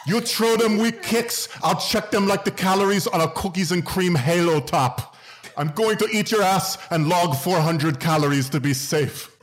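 high-pitched, hammed-up Irish fighter trash talk; bad Irish accent. Water-fasting Conor McGregor threatening an opponent, bad Irish accent. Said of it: "I don't know how to do an Irish accent".

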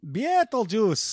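slow, loud Russian accent. Shown as a demonstration. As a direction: draw it out like a Russian. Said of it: "saying Beetlejuice in a Russian accent".

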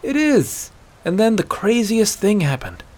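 put-on wide-eyed, chattering kid voice; overstimulated child. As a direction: tell it like an overexcited child. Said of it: "eyes go wide and vapid, like an overstimulated child".

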